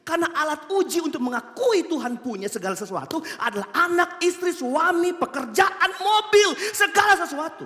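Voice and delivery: preaching that rises to high, loud peaks; mounting sermon climax; building pulpit list. Real, not acted. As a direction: hammer each item harder.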